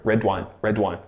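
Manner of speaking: red wine run together, red wine; linked as one word. Shown as a demonstration. Teacher modelling red wine as one word. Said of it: "almost goes together like one word".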